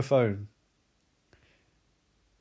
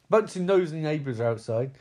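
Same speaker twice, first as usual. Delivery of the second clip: normal talking voice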